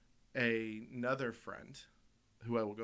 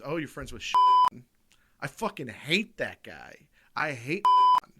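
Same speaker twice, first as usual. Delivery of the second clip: mock loud rant; 3 semitones higher, 10 dB louder than usual. Retold guy hating Sean.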